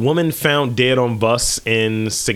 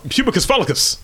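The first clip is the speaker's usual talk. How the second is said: mock spell incantation; 11 semitones higher than usual. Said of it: "Harry Pottering it now?".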